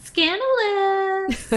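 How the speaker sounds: high, loud, slow scandalized; mock scandalized. Echoes scandalous.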